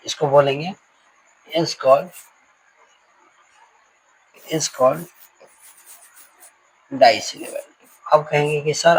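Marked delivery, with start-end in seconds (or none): 1.5-7.6 word by word with long pauses; slow dictation. Real, not acted. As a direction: stretch each word as you write it out. Dictates while writing. (धीमे, एक-एक शब्द बोलकर लिखवाना)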